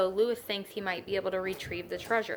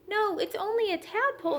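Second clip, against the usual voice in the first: put-on boy reassuring; 12 semitones higher than usual. A narrator's reading. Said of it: "said Louis".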